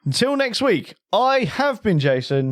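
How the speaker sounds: drawn-out, high-pitched host's sign-off; lifted presenter's farewell; show sign-off. Real, not acted. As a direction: sign off the show with slow flourish. Scripted podcast sign-off.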